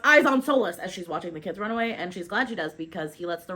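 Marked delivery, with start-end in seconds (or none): 0.0-0.8 loud, high commanding shout; shouted warning. A narrator's reading. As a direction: call out an urgent order. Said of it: "Taryn shouts".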